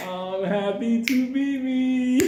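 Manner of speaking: put-on slow, slurred drunk rooster voice; lazy drunken rooster drawl. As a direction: drag it out like a sloshed bird.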